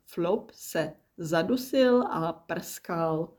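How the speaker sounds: usual speaking voice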